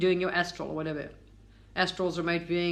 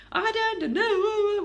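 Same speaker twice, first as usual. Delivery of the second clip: high and slow, dragged out; mock clueless whine; 12 semitones higher, much slower than usual. Mocking viewers' doubts, high slow whine. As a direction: stretch it out like a lost, whiny doubter. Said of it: "as much as you think".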